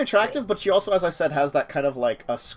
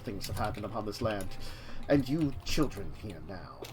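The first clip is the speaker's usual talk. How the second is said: dragon voice; 3 semitones lower, 5 dB quieter than usual. Acted. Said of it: "trying to do dragon voice for a while there".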